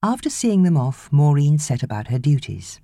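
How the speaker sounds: ordinary delivery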